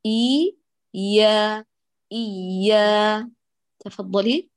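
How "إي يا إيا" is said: إي then يا then إيا, the alif fully open; contrast drill. Shown as a demonstration. Teacher drilling the kasra against the fatha. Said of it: "نريد نقيضها تمامًا".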